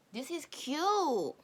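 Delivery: high, slow, loud gushing; gushing coo. Real, not acted. Watching the boy go to school, slow high coo. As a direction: drag it out, melting over something cute. Cooing at cute scene.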